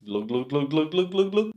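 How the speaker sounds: rapid "glug" gulps climbing in pitch; playful drinking noise; comic glugging. Shown as a demonstration. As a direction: imitate gulping a pint, rising as it empties. Imitates gulping down pints.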